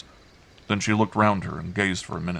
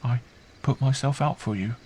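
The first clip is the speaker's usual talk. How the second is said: put-on high, artless child voice; simple child; 6 semitones higher than usual. A narrator's reading. Girl answering her uncle about fear, simple. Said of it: "she answered simply".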